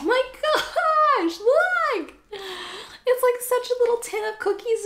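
high laughing, half-crying squeal; excited. Real, not acted. Showing the tin to viewers, high teary squeal. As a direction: squeal through happy tears.

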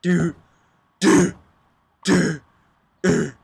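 loud repeated startled dude; real alarmed yelps of dude; shouted dude. Real, not acted. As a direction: call out each dude loud and high. Startled by loud game noises.

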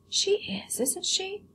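high, soft agreeable shop owner; a hint of a fawning shopkeeper; lightly voiced shopkeeper. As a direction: coo over the doll to the buyer. Shopkeeper Lucius speaking.

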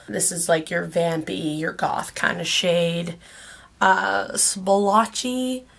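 ordinary talk, nothing marked

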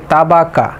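"তাবাকা" with thin, open aa vowels throughout; common mispronunciation. Shown as a demonstration. Teacher showing what many say here, mispronounced light "তাবাকা". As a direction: say "তাবাকা" lightly as taa-baa-kaa. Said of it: "অনেকে বলে থাকে".

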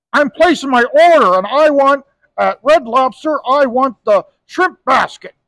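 a loud, blunt voice placing an order; loud gruff order. Retold or imitated. Bar regular ordering at Red Lobster, loud blunt voice. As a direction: boom out the restaurant order. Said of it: "so soft and so sensitive".

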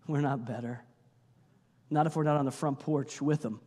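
normal talking voice

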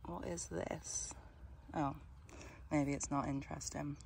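soft whispered question; whispering. Real, not acted. Whispered discovery.

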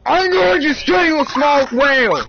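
high, loud frustrated yell; shouting in high-pitched frustration; exasperated scream. Real, not acted. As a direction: explode at the top of your voice. Exploding at the assistant.

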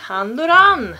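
playful over-French Saint Laurent; exaggerated French pronunciation. Shown as a demonstration. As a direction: lean into "san-lo-raan" for Saint Laurent. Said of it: "Låter nästan som".

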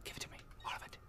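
hushed and hungry; greedy whisper. Real, not acted. Gamer greedily whispers at the bank for all his money. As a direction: whisper it like a miser.

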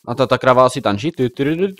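ordinary delivery

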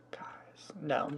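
urgent whisper to the viewers. Real, not acted. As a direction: hush it, as if caught.

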